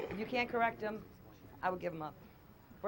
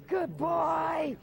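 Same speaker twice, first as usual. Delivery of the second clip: loud praise; 5 semitones higher, 5 dB louder than usual. Real, not acted. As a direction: cheer the dog on. Trainer praises dog loudly.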